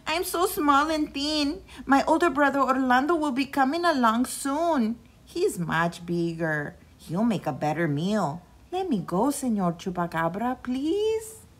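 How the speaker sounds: high, small begging voice; pleading little goat. A narrator's reading. Reynaldo begs the chupacabra to wait for his brother. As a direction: talk your way out of danger.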